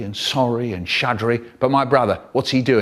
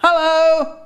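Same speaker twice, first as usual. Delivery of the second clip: very high singsong call; high, drawn-out greeting; mum calling out; 4 dB louder than usual. Acted. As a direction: call through the house as mum. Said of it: "my mum calls out".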